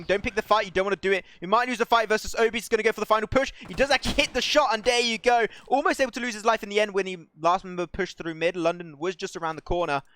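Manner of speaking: high, loud, breakneck-hype esports call; sustained climax commentary at full voice; excited play-by-play. Real, not acted. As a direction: build to a shout as the shot lands.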